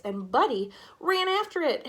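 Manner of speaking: plain reading voice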